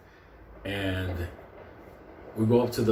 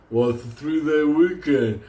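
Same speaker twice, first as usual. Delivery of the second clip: loud, slow, higher-pitched refusal; stubborn worker; 4 semitones higher, 6 dB louder, slower than usual. Retold or imitated. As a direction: drag it out like an unhelpful clerk. Pound worker refuses to help.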